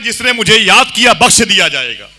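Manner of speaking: high, loud preaching, falling at the close; rousing proclamation. Acted. Preacher quoting his sheikh's promise to followers, rousing proclamation. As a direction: proclaim it to stir the crowd.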